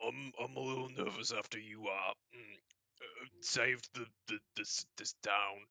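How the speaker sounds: slow, halting apology in an orc voice; bashful orc tripping over words; nervous stuttering orc. Acted. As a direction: stammer it out, long hesitations. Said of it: "he's j-, he's stuttering".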